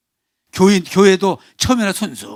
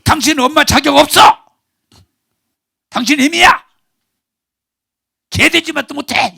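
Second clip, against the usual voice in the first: loud, high furious insults; husband's insults; 9 semitones higher, 7 dB louder than usual. Retold or imitated. Wife retelling her husband's insults, loud and furious. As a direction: hurl the insults as the raging husband. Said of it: "남편이요".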